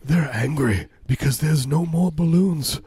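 normal talking voice

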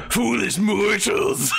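high, slow, shouted ghost voice; slow theatrical villain menace. Acted. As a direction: overdo a spooky elf ghost. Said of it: "No, that's even worse".

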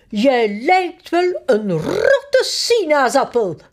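slow, stern mock scolding. Queen sternly insults the king, slowly. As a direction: tell him off with mock severity. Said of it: "kijkt de koning streng aan".

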